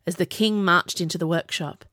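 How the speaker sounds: plain reading voice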